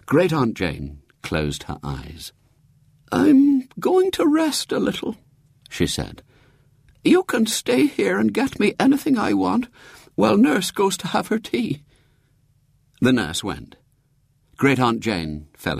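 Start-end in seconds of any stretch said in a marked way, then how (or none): 3.1-5.2 very high-pitched invalid voice; piping old lady holding court from bed; feeble great-aunt. A narrator's reading. Great-aunt Jane inspecting the young visitors, feebly. Dying great-aunt's voice again. 7.0-11.8 very high-pitched invalid voice; piping old lady holding court from bed; feeble great-aunt. A narrator's reading. Great-aunt Jane inspecting the young visitors, feebly. Dying great-aunt's voice again.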